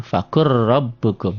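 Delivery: slow "Fakur rabbukum" with a long rolled r at the join; merged double r. Shown as a demonstration. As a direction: recite "Fakur rabbukum" as one phrase, holding the r. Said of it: "fakul jadi fakur".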